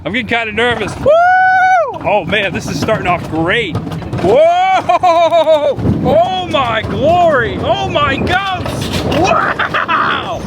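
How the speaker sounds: high, loud thrill-ride whoops; excited yelling. Real, not acted. Riding fast mountain coaster.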